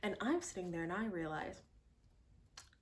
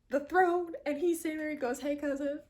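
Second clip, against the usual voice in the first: excited; 8 semitones higher, 8 dB louder than usual. Real, not acted. Emotional climax retelling the scene.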